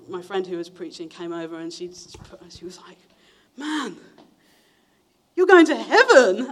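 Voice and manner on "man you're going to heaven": high, much louder, laughing delight; excited congratulation. Retold or imitated. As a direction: burst out with joy for her friend. Friend excitedly congratulates after response.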